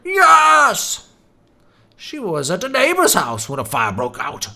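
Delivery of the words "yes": much higher, louder cry; urgent shout. Acted. Villager answering that Relea is in danger, urgent shout. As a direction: yell the answer in a panic. Urgent game character reply.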